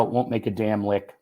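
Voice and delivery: plain reading voice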